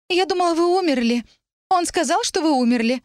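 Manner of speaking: очень высокий плаксивый голос; жалобно оправдывайся, почти рыдая; сквозь всхлипы. A narrator's reading. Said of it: "Она всхлипнула". (слезливо и высоко, с надрывом)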